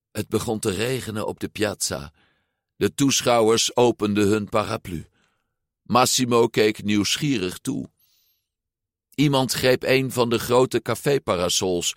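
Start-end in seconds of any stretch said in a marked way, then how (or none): none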